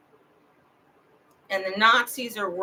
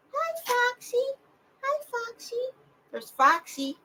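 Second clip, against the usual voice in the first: much higher cooing pet talk, softening as it goes; baby talk to the dog; 12 semitones higher, slower than usual. Acted. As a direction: coo to your little dog in a high voice. Owner cooing to her dog.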